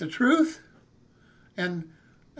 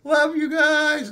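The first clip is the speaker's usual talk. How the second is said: fond sign-off; 6 semitones higher, 5 dB louder, slower than usual. Real, not acted. Signing off to viewers.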